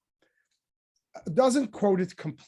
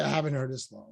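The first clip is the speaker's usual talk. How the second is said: softer and lower, sheepish admission; bashful; 6 semitones lower, 5 dB quieter than usual. Shown as a demonstration. Teacher voicing a bashful Moses, sheepish and soft. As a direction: shrink into the words, embarrassed. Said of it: "which would have been bashful".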